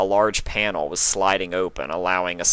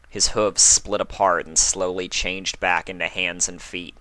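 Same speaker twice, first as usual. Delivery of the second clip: ordinary delivery, nothing marked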